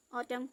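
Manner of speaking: "ऑटम" ending on m, no n; silent N. Shown as a demonstration. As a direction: say "ऑटम" and stop on the m. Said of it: "एन silent हो गया".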